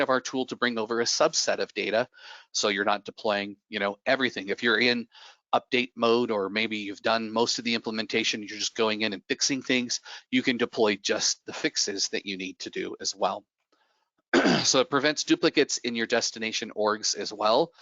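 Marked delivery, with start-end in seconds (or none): none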